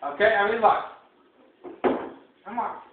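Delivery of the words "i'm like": ordinary delivery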